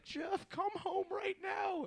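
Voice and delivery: high, strained, drawn-out mock wail; slow high-pitched impression of a crying mother; imitated sobbing mother. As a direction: imitate a tearful mom pleading slowly. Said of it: "sobbing tears".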